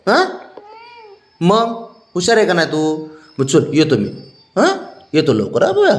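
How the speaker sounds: high, loud sing-song coaxing. Real, not acted. Man coaxing his sulking pet before leaving, high baby talk.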